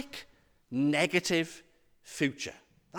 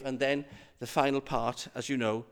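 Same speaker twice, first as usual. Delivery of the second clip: ordinary talk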